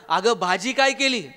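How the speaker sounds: usual speaking voice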